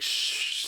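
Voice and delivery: unvoiced cautious shush; hushing shh. Gamer recalls creeping in on first playthrough, hushing. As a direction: hush yourself while creeping along. Hushing himself while sneaking in game.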